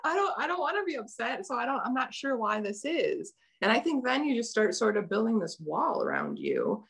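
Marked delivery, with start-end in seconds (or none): 0.0-3.3 mock tearful child. Mimics herself upset as child.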